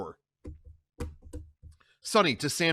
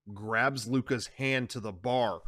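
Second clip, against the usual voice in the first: ordinary talk, nothing marked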